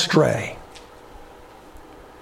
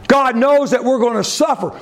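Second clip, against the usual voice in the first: normal talking voice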